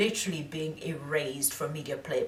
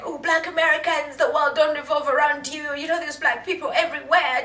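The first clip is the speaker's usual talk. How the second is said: mocking know-it-all; 10 semitones higher, 6 dB louder than usual. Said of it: "People are just saying".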